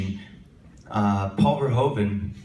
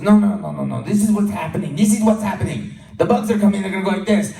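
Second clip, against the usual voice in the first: director explaining; 8 semitones higher, 6 dB louder than usual. Retold or imitated. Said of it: "And he goes".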